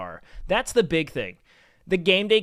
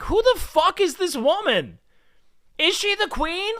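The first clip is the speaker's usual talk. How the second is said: high-pitched, incredulous retold reaction; baffled outrage; 10 semitones higher than usual. Streamer retelling meeting a fair's fake queen, baffled outrage. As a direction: pitch it way up in mock indignation.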